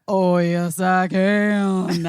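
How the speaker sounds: lightly voiced woozy child, words drawn out; drunk kid. Mother retelling her anesthetized child's answer, mildly drunken. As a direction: imitate a loopy child stubbornly insisting. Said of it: "your drunkest friend in college".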